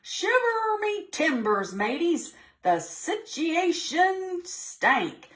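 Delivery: high squeaky pirate cry, stretched out; pirate exclamation. Acted. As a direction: wail it like a panicked old sea dog.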